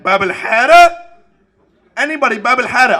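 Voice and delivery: loud, rising call of a show name; hopeful call. Retold or imitated. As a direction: call out hoping someone joins you. Calling out a TV show.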